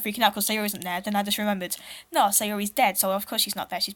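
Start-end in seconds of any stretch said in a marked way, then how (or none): none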